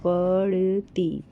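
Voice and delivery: पढ़ती said very slowly, syllable by syllable; teacher sounding out पढ़ती for children. Shown as a demonstration. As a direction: stretch पढ़ती out so each sound is heard. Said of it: "जैसे कि ये".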